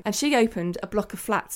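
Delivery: usual speaking voice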